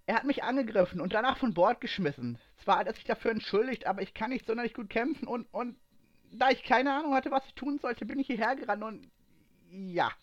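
high, flustered explanation; desperate, out of breath. A narrator's reading. Aslan explains being thrown off board, desperate and flustered. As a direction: blurt it out in distress, pitched well up. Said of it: "Verzweifelt und außer Puste".

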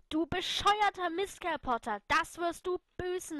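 loud, high furious scream. Acted. Lily screams at Potter after the kiss. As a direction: scream it at him, betrayed. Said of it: "schrie ich".

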